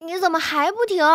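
high-pitched pleading whine; tearful, high complaint; nearly crying. A narrator's reading. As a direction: plead as if close to tears. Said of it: "金陵都要哭了".

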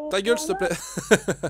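slow, laughing through the words. Real, not acted. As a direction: say it while laughing. Laughing at himself.

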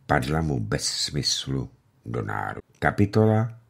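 ordinary delivery

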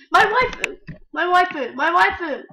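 high, loud call of "my waifu"; excited yell. Acted. Player chasing his crush, high and loud. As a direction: shout for the crush over and over.

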